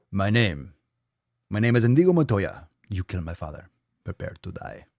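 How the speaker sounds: put-on a movie avenger's Spanish accent, dropping to a hush; Indigo Montoya. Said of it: "combining all sorts of languages and movie references".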